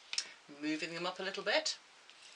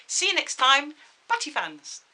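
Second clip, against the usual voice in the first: high, loud, bright goodbye; cheery sign-off; 9 semitones higher, 10 dB louder than usual. Real, not acted. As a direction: wave them off with gusto.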